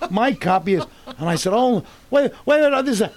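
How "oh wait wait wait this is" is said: high, loud retold panic; flustered protest. Actor retelling his shock at the audition, flustered. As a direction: blurt it out in mock alarm. Caught out just before the audition.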